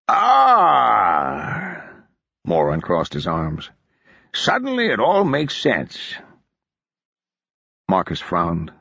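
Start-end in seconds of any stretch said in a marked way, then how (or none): 0.1-1.8 loud drawn-out knowing ah; knowing exclamation. A narrator's reading. Moore realizing the lord ignored Marcus, loud knowing ah. 4.3-6.1 put-on higher, slow, knowing mountain accent; knowing mountain drawl. A narrator's reading. Moore grasping why Marcus acted, slow knowing mountain accent.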